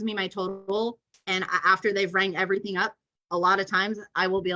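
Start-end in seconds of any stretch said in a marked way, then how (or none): none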